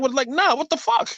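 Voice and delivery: high-pitched, incredulous objection; retold indignation. Northerner reacting to Southern talk, indignant and high. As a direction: climb high in disbelief.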